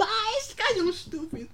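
very high mocking voice; mocking listener. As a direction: sneer it in a squeaky mock voice.

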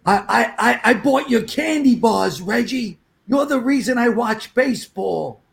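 high, slow, earnest plea; pleading fan. Retold or imitated. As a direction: beg like a starstruck kid.

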